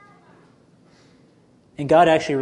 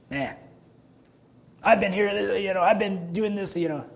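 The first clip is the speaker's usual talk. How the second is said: higher, softer, sulky grumble; grumbling brother; 5 semitones higher, 5 dB quieter than usual. Retold or imitated. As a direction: mimic the sulking older brother. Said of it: "He's grumbling".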